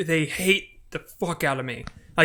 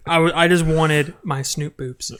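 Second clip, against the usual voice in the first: ordinary delivery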